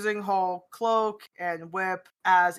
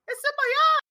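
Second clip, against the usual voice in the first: very high squealed outcry; another goblin voice; 4 dB louder, much faster than usual. Acted. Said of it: "You hear another voice going".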